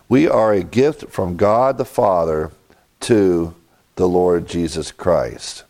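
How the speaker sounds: usual speaking voice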